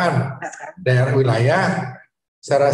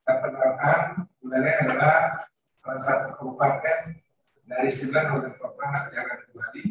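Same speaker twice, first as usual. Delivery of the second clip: echoing mic; 6 dB quieter than usual. Real, not acted. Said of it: "Suaranya nggak terlalu jelas ya".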